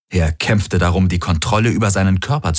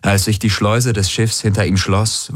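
ordinary delivery, nothing marked

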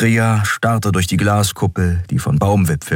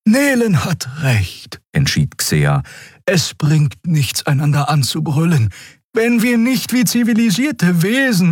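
Xea character voice; 13 semitones higher than usual. A narrator's reading. Xea mediates.

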